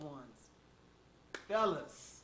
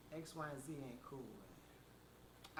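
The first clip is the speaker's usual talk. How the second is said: soft, dropping low at the end; level quietly with a friend; gentle confrontation; 3 semitones lower, 9 dB quieter, slower than usual. Acted. Models gentle talk with a friend.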